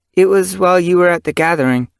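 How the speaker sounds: put-on young kit voice.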